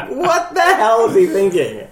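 laughing. Real, not acted. Exasperated laughing outburst.

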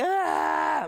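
high, loud yelp of awe; startled cry. Retold or imitated. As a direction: gasp out at the blast. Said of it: "I'm going".

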